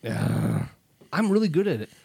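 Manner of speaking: normal talking voice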